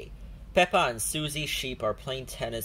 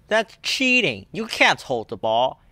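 loud, higher protest; indignant protest; 5 semitones higher, 9 dB louder than usual. A narrator's reading. Danny Dog protests that Rebecca is cheating, loud. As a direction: accuse her loudly.

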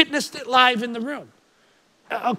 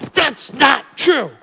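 slow, loud, forceful denial; aggressive; 6 semitones higher, 8 dB louder, slower than usual. Retold or imitated. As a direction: bark the denial at him. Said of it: "very aggressively says".

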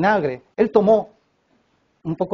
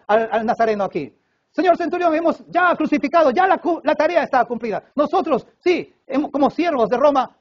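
high-pitched, eager report to a superior; Roman servants reporting; 7 semitones higher than usual. Acted. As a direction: servants proudly announcing a finished task. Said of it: "siervos de los romanos, dirían".

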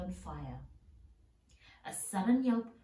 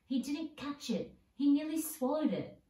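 high, indignant protest, louder at the end; a mock howl of outrage from the loser; 8 semitones higher, 4 dB louder than usual. A narrator's reading. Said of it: "Flint was howling".

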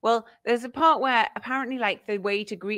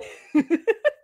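a high laugh bursts out, louder than her talk; high burst of laughter; 13 semitones higher, 5 dB louder than usual. Real, not acted. Co-host laughs at her own royal summons joke, high squealing laugh.

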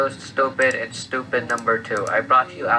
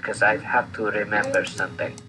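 ordinary talk, nothing marked

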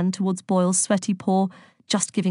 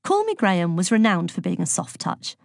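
plain reading voice

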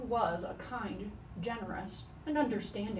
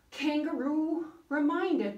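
plain reading voice

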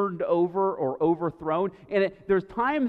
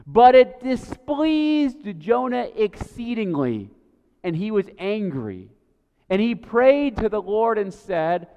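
rising, louder reading that peaks on O Lord; dramatic scripture reading; 3 semitones higher, 6 dB louder than usual. Acted. Pastor reading Jonah's complaint to the congregation, dramatic scripture reading. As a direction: read the scripture as Jonah's angry prayer.

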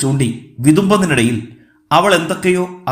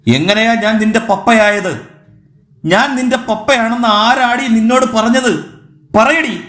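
higher-pitched, exploding demand; angry outburst; 6 semitones higher than usual. A narrator's reading. Man denies he is the little girl's papa, exploding in anger. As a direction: explode at the child, demanding an answer. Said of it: "Hemachandran പൊട്ടിത്തെറിച്ചു".

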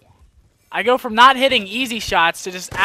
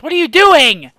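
loud, higher cry of exasperation; exasperated shout; 9 semitones higher, 12 dB louder than usual. Real, not acted. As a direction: shout it in frustration at yourself. Frustrated at own missed shots.

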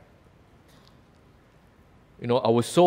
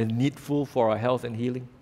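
ordinary talk

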